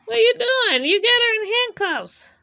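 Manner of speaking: mock alarm. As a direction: pretend to be shocked at the handcuffs. Parent mock alarm at handcuffs.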